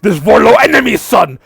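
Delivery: a creature cackling at its own joke, rising to a shout; gleeful creature laugh. Acted. Creature gloats, getting louder.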